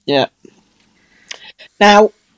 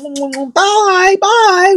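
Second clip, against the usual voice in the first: cheery goodbye; 10 semitones higher, 4 dB louder than usual. Retold or imitated. Hosts waving goodbye at doorstep.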